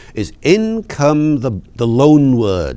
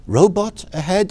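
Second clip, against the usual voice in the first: puzzled question; 6 semitones higher than usual. Retold or imitated. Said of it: "I say".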